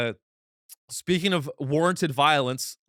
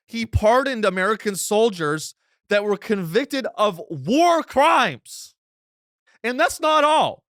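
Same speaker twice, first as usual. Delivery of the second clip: incredulous outrage; 6 semitones higher, 4 dB louder, slower than usual. Real, not acted. Indignant recap of war crimes.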